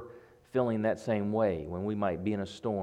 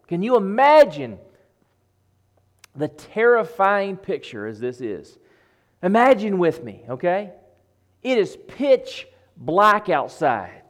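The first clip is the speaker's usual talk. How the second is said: loud, raised storm storytelling; full-force preaching; 10 semitones higher, 11 dB louder than usual. Acted. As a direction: preach the mounting storm with urgency. Preacher paints the terrifying storm.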